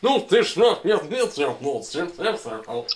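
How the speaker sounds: gibberish Russian like rewound tape, swooping up and down; backwards-sounding Russian. Retold or imitated. Imitating a comedian's skit about Russians talking backwards, backwards-sounding gibberish Russian. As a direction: mimic Russian as speech played in reverse. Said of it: "sounds like people talking backwards".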